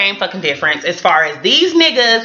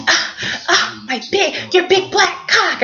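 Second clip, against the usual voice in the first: mock moaning; 8 semitones higher, slower than usual. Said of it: "and she's".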